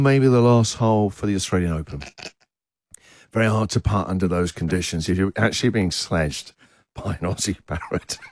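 low, hushed sports-commentary voice; mock golf commentator. Radio host imagining a parrot sledging golfers at the Open, mock hushed commentary. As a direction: drop low and soften towards the end, as if commentating on a putt.

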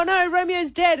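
high-pitched stage lament; mock Juliet lament. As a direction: imitate an actress holding back a laugh. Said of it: "trying to keep a straight face".

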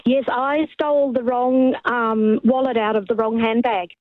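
normal talking voice

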